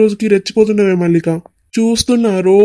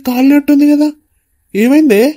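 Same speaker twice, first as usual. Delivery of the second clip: higher, louder worried questions; alarmed concern; 6 semitones higher, 3 dB louder than usual. Acted. Brother asks his sister what happened, alarmed. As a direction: rush in alarmed at her burned face. Brother sees burned face.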